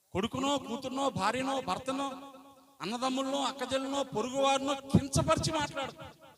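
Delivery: rising sermon emphasis, word after word; preaching. Acted. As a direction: build up the list from the pulpit. Preacher raising voice on list.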